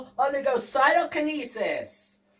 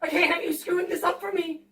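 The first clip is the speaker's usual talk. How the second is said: mock desperate plea; 4 semitones higher, 5 dB louder, faster than usual.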